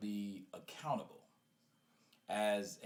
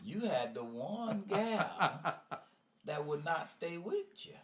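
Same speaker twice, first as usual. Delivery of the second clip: high, drawn-out grandma voice; amused imitation of a scolding Southern grandma; grandmother; 9 semitones higher, 4 dB louder, slower than usual. Grandmother telling her grandson he picked the wrong gal, high scolding grandma. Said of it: "she said".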